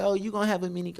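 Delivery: high, sing-song teasing mom voice; playful mom. Retold or imitated. Mom explaining the mini Cokes at the baby shower, high and teasing. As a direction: deliver the pun like a proud mom.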